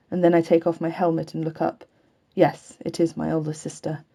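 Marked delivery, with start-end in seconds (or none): none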